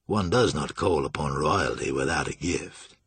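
low, courtly pronouncement; solemn Julian. A narrator's reading.

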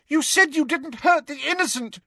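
very high, distressed protest; Hermione shouting. A narrator's reading. Girl in tears accusing the centaurs, shouting. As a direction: cry out in desperate protest. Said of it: "shouted Hermione".

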